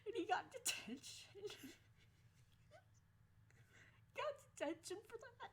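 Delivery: high, squeaky, overcome with laughter; barely getting the words out through laughs; laughing to tears. Real, not acted. Telling how her defender got detention, laughing to tears. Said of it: "Oh God, I'm crying".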